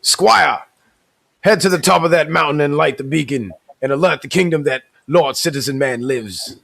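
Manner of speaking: put-on old English lord proclaiming a decree; lightly voiced medieval lord commanding a squire; medieval accent. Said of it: "let me get my medieval accent on".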